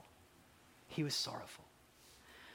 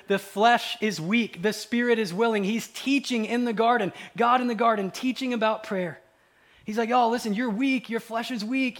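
raised, high, driving sermon voice; impassioned preaching; 7 semitones higher, 8 dB louder than usual. Acted. Preacher urging the congregation to pray first, impassioned. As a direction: press the congregation with loud urgency.

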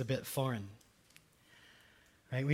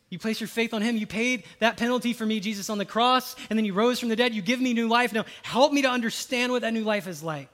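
louder, higher earnest prayer to Jesus; sample prayer; 5 semitones higher, 6 dB louder than usual. Acted. Pastor models a prayer of faith, in a raised earnest voice. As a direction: pray it out with fervour.

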